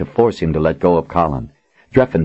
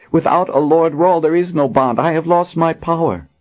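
high, bitter woman warrior; a warrior mourning her power; Kara lost; 10 semitones higher than usual. Acted. Kara voiced in the story.

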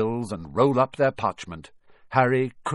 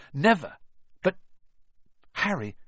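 ordinary delivery

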